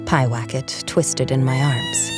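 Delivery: ordinary delivery, nothing marked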